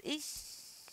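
whispered ixi, soft esqueci; a quiet oops of forgetting; hushed ixi. Shown as a demonstration. Teacher shows forgetting, softly muttered. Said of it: "Aí você bota a mão na cabeça".

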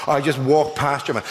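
usual speaking voice

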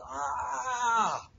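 long slow "aah" of pain; patient's drawn-out groan. Retold or imitated. Patient waking at the last stitch, a long groan.

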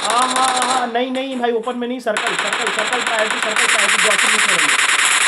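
high, tense run of warnings; urgent warning. Real, not acted. Streamer warning a team about the circle, urgent.

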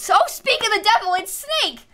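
high-pitched, delighted surprise; amazed exclamation. Real, not acted. Streamer spotting the villager just listed, amazed exclamation. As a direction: burst out at the coincidence, voice leaping up.

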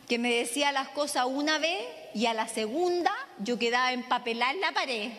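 ordinary talk, nothing marked

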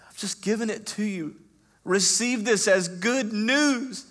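high, loud sermon climax; ringing good news; full-force preaching. Acted. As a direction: preach it over them.

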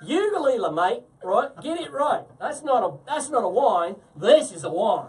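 a bad Australian accent pushed for laughs; loud mock Aussie voice; terrible Australian accent. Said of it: "That's my terrible Australian".

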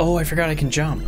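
ordinary talk, nothing marked

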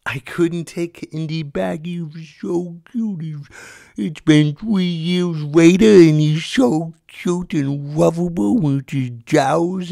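put-on high, slow doting baby voice; gushing baby talk. Comic melts over his cute dog, gushing baby talk. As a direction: coo it like a smitten pet owner.

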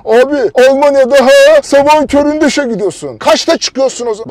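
high, loud mimicry of a complainer; exasperated mocking quote. Vlogger imitating complaining emigrants, exasperated mockery. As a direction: throw the complaint back at full volume. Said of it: "inanılmaz çıldırtıyor beni ya".

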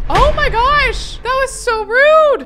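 slow, high, loud shocked gasp of words; stunned and offended. Real, not acted. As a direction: reel back at the rudeness. Shocked by sim's insult.

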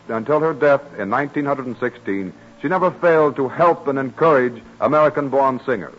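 normal talking voice

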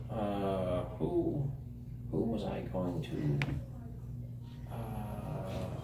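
soft, slow, distracted mumble; muttering to himself. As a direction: trail off as if thinking aloud. NPC mumbles searching for recipient.

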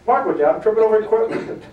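laughing through the words; laughing retelling. Retold or imitated. Trainer recalling tripping half-blind, laughing. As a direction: chuckle as you say it.

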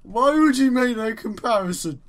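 loud drawn-out groan of revulsion, then outraged question; mock disgust. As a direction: act utterly revolted, dragging the first words out. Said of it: "I am gonna be disgusted".

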